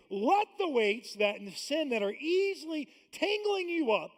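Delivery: high, loud, driving sermon voice; full-force preaching. Acted. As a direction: preach it hard to the congregation.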